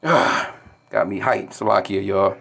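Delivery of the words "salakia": ordinary delivery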